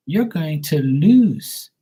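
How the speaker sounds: normal talking voice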